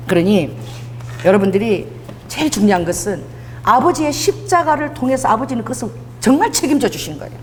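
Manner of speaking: normal talking voice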